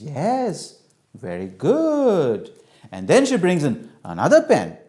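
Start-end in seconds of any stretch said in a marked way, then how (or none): 0.0-2.5 very drawn-out, lilting approval; long, swooping motherly approval; praising mother. Acted. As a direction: praise a small child slowly and sweetly. Mother praises child's right answer.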